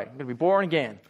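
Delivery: put-on low, joking American accent. Preacher joking with congregation about hearing "born again", American accent. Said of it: "I just think American accent straight away".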